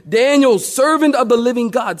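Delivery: high, loud mock lament; lamenting cry. A narrator's reading. King calling to Daniel at the den, lamenting. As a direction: call out high in mild mourning. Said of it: "weeping out with a mourning, a lamenting voice".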